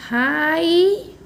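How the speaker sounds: हाई with the vowel held long; slow teaching model of हाई; slow read-along. Shown as a demonstration. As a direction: draw out हाई for pupils to join in. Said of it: "फिर से एक बार साथ में पढ़िए".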